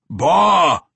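loud growled "Bah!"; booming dwarf growl. A narrator's reading. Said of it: "he growled in his booming bass voice".

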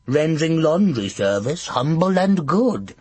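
high sing-song witch. A narrator's reading. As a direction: chirp the rhyme like a hag. Tall witch rhyming.

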